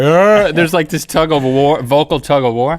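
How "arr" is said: short growl of struggle; mock growl. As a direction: growl like a tug of war. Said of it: "and then we both like".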